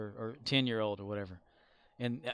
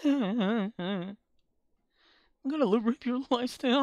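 high, loud mocking rant; mock angry quote; 11 semitones higher, 8 dB louder than usual. Streamer imitating the angry player's threat, high and loud. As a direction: imitate his furious threat, laughing. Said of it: "You can hear him go like".

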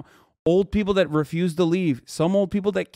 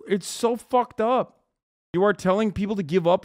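usual speaking voice

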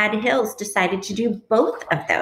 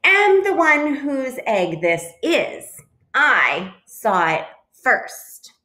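self-important claim, starting high; chest-out boast, slowing at the end; puffed-up; 6 semitones higher, 4 dB louder, slower than usual. A narrator's reading. Duck claims the egg from Goose, puffed-up. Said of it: "puffing out his feathered chest".

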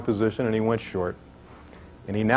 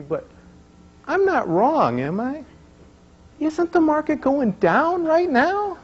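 put-on much higher, slow, tearful child's plea; a small girl close to tears, pleading; crying little girl; 12 semitones higher than usual. Upset daughter pleading with her father, in a much higher, tearful child's voice. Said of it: "she starts crying a little bit".

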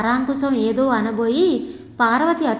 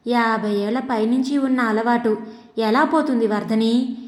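ordinary delivery

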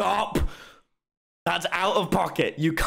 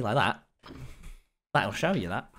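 ordinary talk

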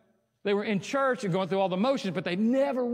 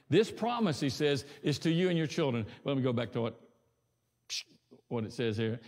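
ordinary talk

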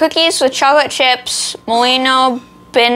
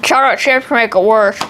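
chewing between words; talking while chewing; faster than usual. Real, not acted. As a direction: mouth partly full of cookie. Seen 2: Hand at mouth after cookie bites; jaw appears to be chewing while speaking.

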